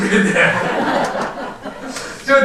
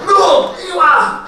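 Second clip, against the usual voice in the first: calling shout; 8 semitones higher, 4 dB louder, much slower than usual. Retold or imitated. Retold pastor calling him over.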